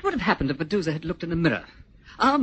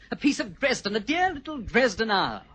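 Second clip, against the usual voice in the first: mocking sneer; 6 semitones higher than usual. Sneering at the tutor.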